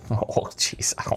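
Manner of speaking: whispered under the breath; whispering. Real, not acted. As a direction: whisper it nervously to yourself. Nervous aside while fumbling switch.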